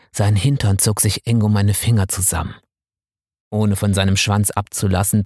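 ordinary delivery, nothing marked

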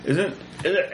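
ordinary talk

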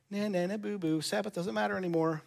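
a light mock nyah-nyah taunt; childish taunt. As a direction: tease like a kid on a playground. Mocking taunt voiced for Mark.

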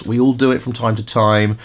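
ordinary talk, nothing marked

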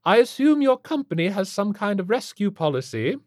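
high, loud, haughty inquiry; imperious rich matron. A narrator's reading. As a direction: demand it like an affronted society lady. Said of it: "Missus Flintlock declared".

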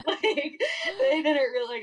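laughing. Real, not acted. Laughing at the memory.

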